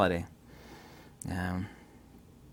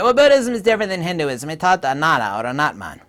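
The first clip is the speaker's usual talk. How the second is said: lightly mocking higher, louder quote of an opponent; mimicking objectors; 4 semitones higher, 4 dB louder than usual. Lecturer quoting those who disagree, mildly mimicking. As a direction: parrot the objection, pitched up at the start.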